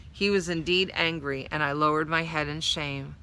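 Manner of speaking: usual speaking voice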